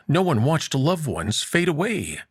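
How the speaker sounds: ordinary delivery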